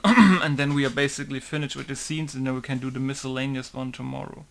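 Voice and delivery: usual speaking voice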